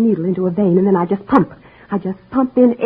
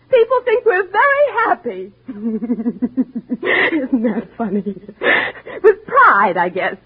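tearful bitter laugh; much slower than usual. Acted. Wife breaks down over pretense.

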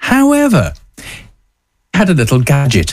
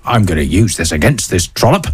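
lightly put-on Taiwanese accent; a hint of a Taiwanese accent; 7 semitones lower than usual. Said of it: "in my best Taiwanese voice".

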